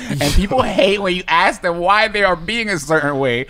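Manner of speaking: ordinary talk, nothing marked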